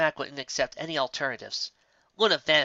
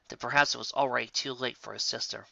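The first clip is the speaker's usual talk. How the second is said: usual speaking voice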